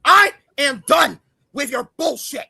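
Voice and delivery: high, loud, real fury; angry outburst. Real, not acted. Streamer done with a rival's insults, furious. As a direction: blow up and refuse to listen.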